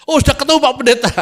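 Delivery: high, quick, eager call; acted burst of excited relief; excited announcement. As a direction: call out a sudden find to the pastor. Retells finding the verse at last.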